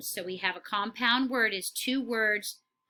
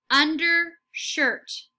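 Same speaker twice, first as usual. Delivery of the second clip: slow, louder undershirt; slow model word; 6 semitones higher, 6 dB louder, much slower than usual. Shown as a demonstration. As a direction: stretch "Undershirt" out slowly, part by part. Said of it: "say it right before the teacher".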